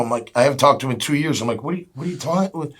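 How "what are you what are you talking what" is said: can't believe who's on the phone; high, tumbling what-are-you questions; incredulous surprise. Retold or imitated. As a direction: pitch jumps up, stammering in disbelief. Stunned by old coach's call.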